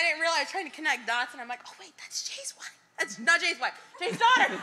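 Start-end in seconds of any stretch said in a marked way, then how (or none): 1.7-2.8 high, hushed stage whisper; acted whisper. Acts out a private realization. 3.0-4.5 rising to a high, loud laugh; acted surprised realization. Acts out correcting her mistake.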